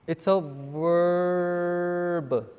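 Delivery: very slow, stretched-out VERB; drawn-out answer. Real, not acted. Teacher prompting the class for the answer, drawn out. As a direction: hold the word verb long, leading the class to it.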